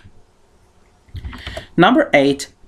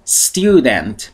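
normal talking voice

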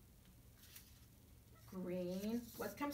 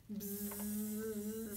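buzzy housefly. Acted. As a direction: buzz like a little fly. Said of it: "said the fly".

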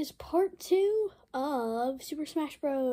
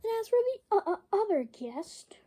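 normal talking voice

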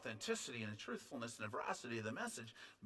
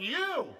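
"you" called out high and loud; single out the listener with a forceful "you"; emphatic YOU; 12 semitones higher, 10 dB louder than usual. Shown as a demonstration. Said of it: "if we're doing it vocally".

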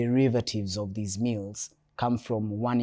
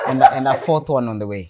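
laughing; 3 semitones higher, 11 dB louder than usual. Real, not acted. Guest joking about a baby coming.

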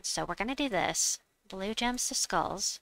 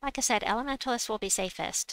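ordinary talk

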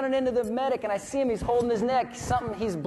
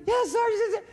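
Floyd answering. Retold or imitated. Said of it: "He's like".

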